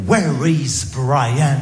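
put-on very slow, French-accented textbook English; heavy French accent, slow schoolbook English; school English accent. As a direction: recite it like a bored classroom drill. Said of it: "question existentielle".

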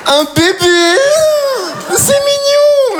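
very high slow squeal; mock gushing. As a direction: gush over the baby. Fake delight at baby.

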